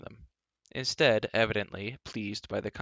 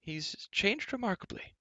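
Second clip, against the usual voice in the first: high-pitched character voice, marvelling; amazed bard; 11 semitones higher, 4 dB quieter than usual. A narrator's reading. Bard comments on changed giant.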